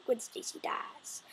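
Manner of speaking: slow hushed whisper of "Gwen Stacy dies"; voiceless dramatic whisper. Acted. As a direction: breathe it like a secret, slowly. Whispered then repeated aloud.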